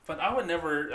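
normal talking voice

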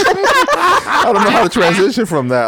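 words squeezed out through high laughter; amused, can barely get it out; laughing. Real, not acted. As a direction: talk while still cracking up. Laughing after the crude joke.

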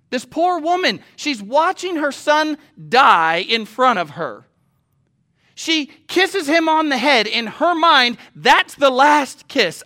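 loud, high-pitched, slow and emphatic sermon; impassioned preacher stretching key words; forceful preaching. Real, not acted. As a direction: cry it out to the congregation.

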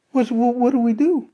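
high, loud, stammering panic; panicked coworker. Retold or imitated. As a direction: trip over the first word in acted alarm.

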